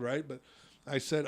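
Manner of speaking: ordinary delivery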